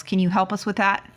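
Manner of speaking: usual speaking voice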